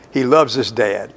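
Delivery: normal talking voice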